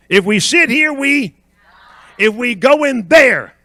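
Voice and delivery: high, loud pulpit cadence; forceful preacher leading the refrain; call-and-response preaching. Real, not acted. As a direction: pitch each line up for the congregation to finish. Leads congregation in refrain.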